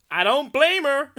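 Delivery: put-on loud, high, drawn-out American accent; mock commenter accent. Host reading the next online comment, in a loud put-on American accent. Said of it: "read them in the accents, I think".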